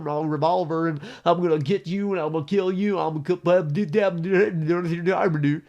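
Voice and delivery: high, loud hammed-up threats. Mocking a right-wing guy's threats with hammed-up fury. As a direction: rant like an offended tough guy. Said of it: "You know, like".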